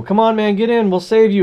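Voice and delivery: high, loud hammed-up plea; urgent call from a helicopter; mock helicopter rescuer. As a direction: shout up to the roof. Helicopter crew in the joke.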